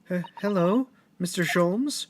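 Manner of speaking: normal talking voice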